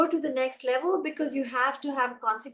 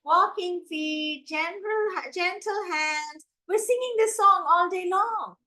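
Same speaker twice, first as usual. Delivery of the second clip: slow, higher sing-songy lilt; passive sing-song; 6 semitones higher than usual. Shown as a demonstration. Trainer mimicking grown-ups to children, passive sing-song. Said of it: "very passive sing-songy voice".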